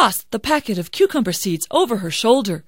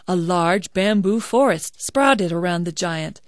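usual speaking voice

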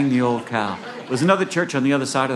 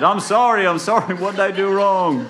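high, loud comic pleading; frantic apology; 10 semitones higher, 6 dB louder than usual. Acted. Jack begging the angry mourners, high loud apology. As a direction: cry out sorry under a hail of blows.

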